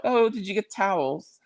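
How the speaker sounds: lightly mimicking a clueless question; mock Kyle. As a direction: pitch it up to mimic Kyle asking. Mocking Kyle's question.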